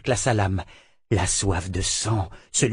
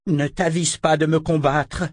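rugissement menaçant; 6 semitones higher than usual. A narrator's reading. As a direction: menace rugie, plus aiguë. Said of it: "rugit Verna". (Verna rugit contre Richard)

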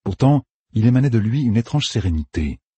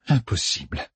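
softly breathed word; 5 dB quieter than usual. A narrator's reading. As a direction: barely breathe it out in shock. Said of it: "souffla-t-elle".

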